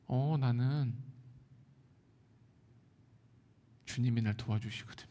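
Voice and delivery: soft, low, unvoiced reply; 조용히 속삭이듯 평안하게; calm whisper. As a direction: whisper it with quiet assurance. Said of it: "너는 이렇게 평안하니".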